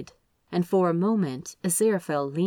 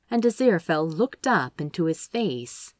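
usual speaking voice

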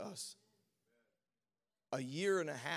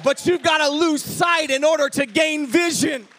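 loud, high, shouting sermon with rising fervour, stretching key words; full-force preaching; 10 semitones higher, 16 dB louder than usual. Acted. Pastor preaching to his congregation about the bleeding woman, full-force preaching. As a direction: preach it to the rafters, drawing out each Twelve years.

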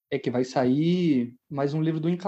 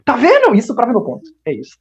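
triumphant; 7 semitones higher, 10 dB louder than usual. Real, not acted. Friend gloats at being proven right.

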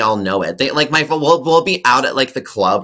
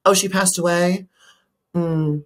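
imitated TV host breezing past bad news; higher surprised question, then move briskly on; mock surprise, then casually change the subject; Wendy Williams; 7 semitones higher than usual. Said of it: "And Wendy goes".

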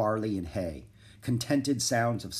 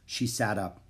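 usual speaking voice

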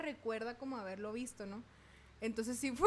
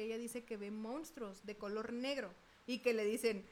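ordinary talk

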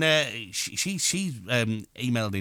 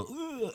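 dying groan; 11 semitones higher, 11 dB quieter than usual. Acted. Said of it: "I had to, like, go".

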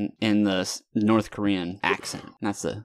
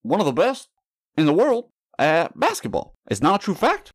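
a lightly voiced Obama, a little higher; Obama impression; 4 semitones higher than usual. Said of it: "your Obama accent sounds just like Bernie's".

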